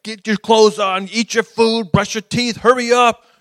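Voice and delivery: put-on high, loud hurrying of the kids; exasperated parent barking orders; worked up with the kids. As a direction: rush the kids out the door, getting worked up. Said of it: "I get a little worked up with my kids".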